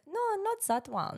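put-on very high, squeaky German-accented postman. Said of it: "he has a German accent".

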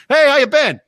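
high, loud bro-ish barks; rowdy soldier greeting. Retold or imitated. Imitating young soldiers greeting each other, high loud bro barks. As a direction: hail your army buddies. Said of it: "I'm like, listen".

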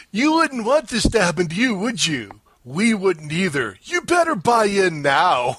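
sing-song salesman, building to a punchy order; mock insurance pitch. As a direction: act out a TV ad, high opening, forceful finish. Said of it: "it's like this".

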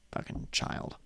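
muttered aside. Real, not acted. Muttering to viewers about still being kids. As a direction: drop to a breathy aside.

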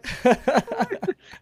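a long, high burst of laughter. Real, not acted. Laughs at people leaving stream. (risa aguda y larga)

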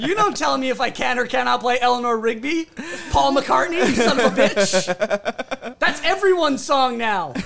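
loud, laughing fake fury; mock rant. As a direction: rant at him playfully.

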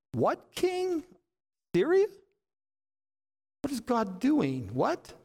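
high-pitched, baffled questioning; astonished, voice leaping up; incredulous. Acted. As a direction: squeal it in mock disbelief. Acting out disbelief at God's order.

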